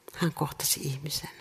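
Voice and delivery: soft unvoiced whisper. Real, not acted. Preacher telling the congregation how Jesus met people, whispered. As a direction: drop to a reverent whisper.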